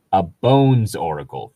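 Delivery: usual speaking voice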